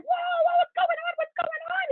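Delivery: very high squealing mock alarm; alarmed crowd. Neighbours seeing mom's pants on fire, shrill panic. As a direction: imitate onlookers shrieking in confusion.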